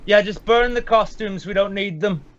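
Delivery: high-pitched call across the room. Pretend. Interrupting to instruct AJ about the costumes, calling out high. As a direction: call to someone off mic.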